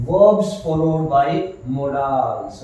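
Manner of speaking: slow, even dictation pace. Shown as a demonstration. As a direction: spell the rule out slowly for the class. Teacher dictating the rule slowly. (धीमे-धीमे लिखवाते हुए)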